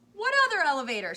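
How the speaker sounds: high, very loud, fast panicked scream. A narrator's reading. Missus T V hearing of another elevator, panicked scream. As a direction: shriek it in alarm. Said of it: "screamed Missus T V".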